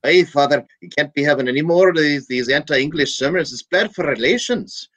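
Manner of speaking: lightly voiced bishop scolding his priest; stern bishop. Bishop scolds the priest over his sermons, mildly stern. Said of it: "so the bishop hauls him in".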